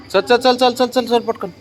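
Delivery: high-pitched rapid coaxing of a dog; sing-song dog-calling voice. Real, not acted. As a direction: chirp it up high to hurry the dog along.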